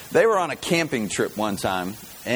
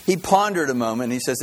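ordinary talk, nothing marked

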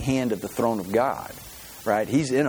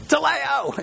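joyful shout; 14 semitones higher than usual. Pretend. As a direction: burst out "Teleo!" in triumph. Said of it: "can I say teleo?".

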